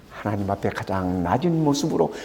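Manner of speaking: ordinary talk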